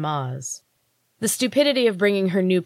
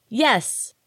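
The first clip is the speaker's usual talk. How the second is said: higher, eager yes; excited cry; 7 semitones higher than usual. Acted. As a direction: blurt it out with sudden hope. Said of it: "Francie cried".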